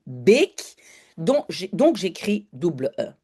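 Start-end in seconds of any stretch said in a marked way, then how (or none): 0.1-0.8 "bake" with a long pure é; "bake" as Dutch beek, vowel lengthened; long closed E. Shown as a demonstration. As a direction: hold the é in "bake", no glide. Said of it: "J'entends E".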